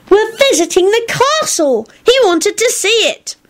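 put-on very high child voice; defensive boy. A narrator's reading. Boy defends himself to headmistress.

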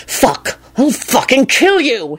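snarled threat, slow and rising. A narrator's reading. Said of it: "he snarled".

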